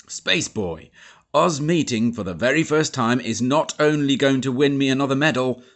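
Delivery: grand army major making a speech; booming Major. A narrator's reading. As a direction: proclaim it like a proud officer. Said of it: "announced Major Majors".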